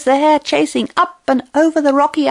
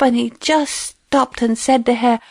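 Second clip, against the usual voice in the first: usual speaking voice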